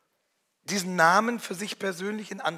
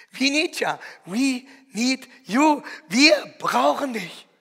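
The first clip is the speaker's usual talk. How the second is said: slow, raised, loving call of her name; calling a beloved daughter; 5 semitones higher, 5 dB louder, slower than usual. Acted. Parents lovingly calling their daughter's name, slow and raised.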